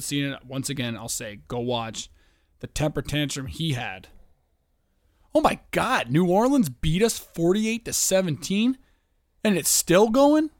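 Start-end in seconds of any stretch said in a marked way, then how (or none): 5.3-10.5 high, loud disbelief at the score; shocked outburst. Real, not acted. To the listeners about the Falcons game, loud shocked disbelief. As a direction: cry out in disbelief, slowing on the last words.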